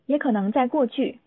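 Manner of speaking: ordinary talk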